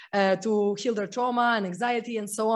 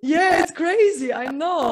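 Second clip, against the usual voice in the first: real burst of amazement, much higher and louder; حماس حقيقي; excited; 4 semitones higher, 5 dB louder than usual. Real, not acted. Reacting to her own story.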